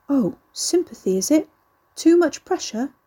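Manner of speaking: high, mock-pitying questions; mocking sympathy. Grandson mocks gran's sympathy back.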